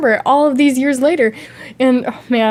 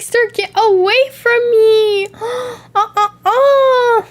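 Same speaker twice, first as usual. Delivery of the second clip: high, slow mock-panicked whimper; hammed-up frightened plea, higher; mock panicked cry; 9 semitones higher, slower than usual. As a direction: squeal it like you're fleeing a creep. Panicked reply to stranger.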